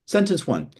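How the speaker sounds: ordinary talk, nothing marked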